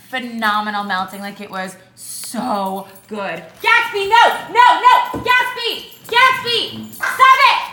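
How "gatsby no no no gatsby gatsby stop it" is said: loud, high, urgent stop it and no; scolding the dog. Real, not acted. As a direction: shout the dog down, much higher and louder. Dog misbehaving again.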